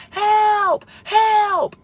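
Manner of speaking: high, loud cries of help; screaming for help. Retold or imitated. Trapped in a cell, screaming for help to anyone upstairs. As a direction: yell help as if locked in. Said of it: "I started screaming like crazy".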